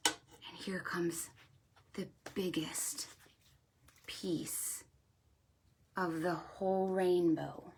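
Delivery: slow, hushed whisper building suspense; suspenseful whisper. Acted. Host whispering to kids before the biggest piece goes in. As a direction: whisper it slowly, drawing the kids in.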